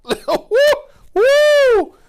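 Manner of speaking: laughing, very high, loud woo; joyful whoop. Real, not acted. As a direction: whoop with delight. Joyful shout about God's hope.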